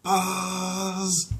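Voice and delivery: high, steady buzz; alarm buzzer. Acted. The pest control alarm going off, a high steady buzz. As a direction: imitate an alarm bell.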